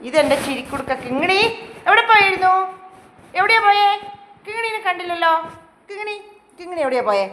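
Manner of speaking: high, loud fond calling; calling the pet. Real, not acted. Owner calling her pet Kingini, loud and high. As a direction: call out to her high and bright.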